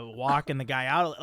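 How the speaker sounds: usual speaking voice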